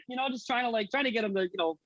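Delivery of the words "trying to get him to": usual speaking voice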